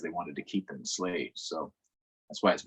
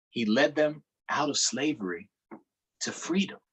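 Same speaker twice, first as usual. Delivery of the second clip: steady read-aloud storytelling voice; story narration; 4 semitones higher, 4 dB louder than usual. A narrator's reading. Teacher reading a book aloud to children, narrating. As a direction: read the story to the class, measured.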